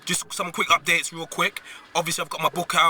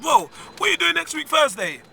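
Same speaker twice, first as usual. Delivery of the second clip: raised retold question; 10 semitones higher than usual. Retelling his question to friend.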